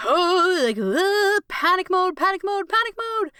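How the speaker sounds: high, breathless imitation of her own panic; a hammed-up retelling of being in panic mode; mock panic.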